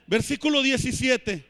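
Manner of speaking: normal talking voice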